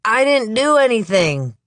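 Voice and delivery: put-on high, loud spat denial in a slight Southern accent; slight Southern accent, spat out defiantly; spitting Southern. Suspect spitting at the police, slight Southern accent, high. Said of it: "spits out in a slight Southern accent".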